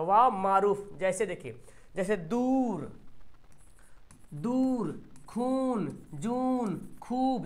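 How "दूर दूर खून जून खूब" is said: दूर, खून, जून with the oo held long; stretched long oo. Shown as a demonstration. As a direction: drag out the vowel in each of दूर, खून, खूब. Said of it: "देखिए, वाव को खींच रहे हैं". (दूर, खून, जून में ऊ खींचकर)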